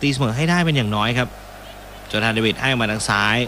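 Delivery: brisk football commentary call; live play-by-play. Acted. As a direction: follow the action as it happens.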